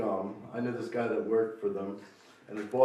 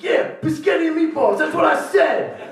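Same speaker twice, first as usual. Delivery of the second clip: much higher, louder mock stranger voice; high pushy stranger; 10 dB louder than usual. Stranger pushing biscotti on him, high loud voice. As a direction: ham up an eager weirdo.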